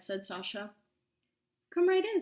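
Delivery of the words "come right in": high, loud, falsely sweet witch invitation; sly witch welcome. Acted. Witch luring Sasha into her hut, high and loud. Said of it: "said the witch".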